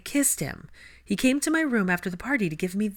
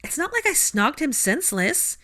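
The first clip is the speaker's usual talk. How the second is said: much higher, flustered protest; 6 semitones higher than usual. A narrator's reading. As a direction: deny it in a rush of embarrassment. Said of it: "protested Hermione".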